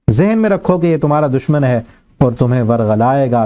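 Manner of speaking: ordinary talk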